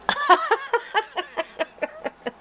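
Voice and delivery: bright laugh at her own joke; high laugh. Real, not acted.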